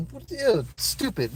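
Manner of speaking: put-on disgusted ex-girlfriend. Streamer mimics ex-girlfriend brushing him off, scornfully. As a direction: toss it off like a scornful ex. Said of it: "she was, like, thrown back".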